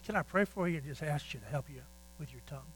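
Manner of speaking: soft, quiet and tender; gentle offer. Retold or imitated. Preacher gently offering the cursing mechanic prayer, softly. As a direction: ask it softly, like offering a hand.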